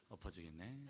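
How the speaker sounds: soft, gentle mumble with a laugh; mild grumble. Retold or imitated. Reformed driver cut off on the road, soft laughing mumble. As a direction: mutter it meekly, laughing. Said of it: "지금은 누가 차를 슝 지나가도".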